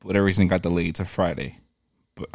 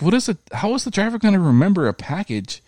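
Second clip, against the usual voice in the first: high, worked-up disbelief; exasperated; 7 semitones higher than usual. Retold or imitated. Retelling how he argued with the FedEx agent, pitched up. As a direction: rant it up high and fast. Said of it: "I was like".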